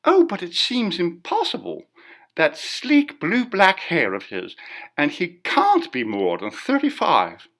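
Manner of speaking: a woman calling out in dismay over the chatter; dismayed exclamation. A narrator's reading. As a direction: rise above the murmur in shocked disbelief. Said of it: "a woman's voice rose above the rustle".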